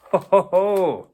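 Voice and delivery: loud hearty pirate ho ho ho; pirate laugh. Acted. Pirates laugh on the diving board, loud and hearty.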